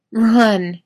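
strained urgent cry; choked out. A narrator's reading. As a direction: choke the word out. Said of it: "he chokes out".

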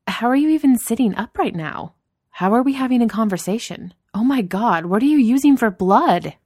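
loud, high, aghast boss voice; Danny, mock horror. Boss aghast at a colleague without coffee, mock horror.

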